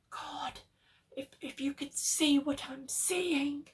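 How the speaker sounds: stammering panic, high; shaky frightened call. A narrator's reading. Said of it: "now called from below in a shaky whisper".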